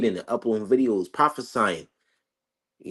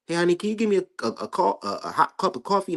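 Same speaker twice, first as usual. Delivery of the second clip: higher-pitched casual housewife asking a favour; mock casual request; 8 semitones higher than usual. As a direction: play a woman breezily asking her honey for something. Acting out an untroubled woman giving birth.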